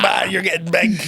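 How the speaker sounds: teasing a client, mock-excited; mock hype. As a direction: cheer him on like a hyped mate. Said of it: "I was like".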